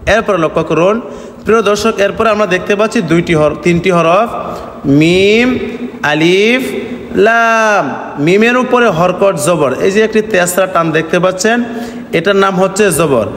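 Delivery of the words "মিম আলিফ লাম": মিম, আলিফ and লাম each stretched long; name each letter slowly, holding its vowel; separate, sustained letter names; drawn-out letter names. Shown as a demonstration. Teacher names letters for learners.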